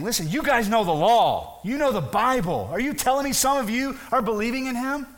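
incredulous rebuke. Acted. Pharisees lecture those who know the law, incredulous. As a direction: press them in rising disbelief.

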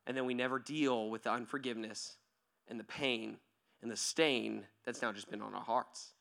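usual speaking voice

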